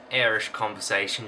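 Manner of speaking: usual speaking voice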